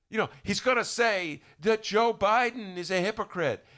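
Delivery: ordinary talk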